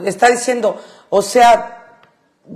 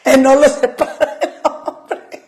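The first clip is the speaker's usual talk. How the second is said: entre risas; 2 semitones higher than usual. Real, not acted. Repite la frase riéndose.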